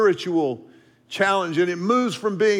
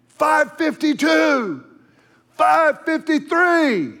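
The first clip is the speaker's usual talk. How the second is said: slow, higher, louder shouting of the times; urgent coach yelling; 8 semitones higher, 4 dB louder, much slower than usual. Retold or imitated. Coach yelling times at runners crossing the line, urgent shout. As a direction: call out the times urgently, drawing them out. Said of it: "coach starts yelling with a little more urgency".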